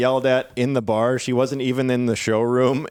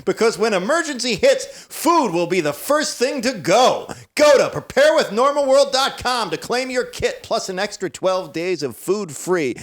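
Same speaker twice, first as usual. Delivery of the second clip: ad read; 7 semitones higher, slower than usual. Acted. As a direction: push the offer like a late-night infomercial. Co-host continues ad read.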